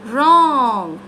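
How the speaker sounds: "Wrong" drawn out with a falling swoop; up-and-down tune. Shown as a demonstration. Coach isolates the key word, "Wrong" swept up and down. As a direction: stretch "Wrong", pitch arching then dropping. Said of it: "Up and down".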